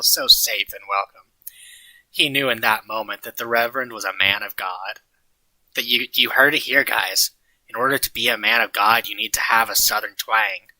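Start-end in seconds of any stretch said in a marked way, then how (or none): none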